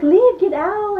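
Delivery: high mock-angry dismissal; angry order. Girl telling the guy to leave, angry order.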